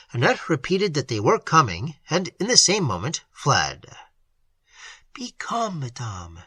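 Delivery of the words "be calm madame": calm the frightened aunt; soothing. A narrator's reading. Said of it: "said Emily".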